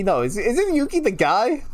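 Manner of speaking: ordinary delivery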